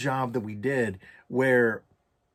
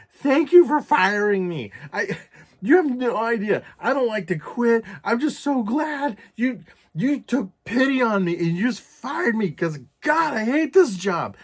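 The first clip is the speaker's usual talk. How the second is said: psychopathic outburst, retold; 10 semitones higher, 5 dB louder than usual. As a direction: gush thanks like someone coming unhinged. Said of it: "like a fucking psychopath".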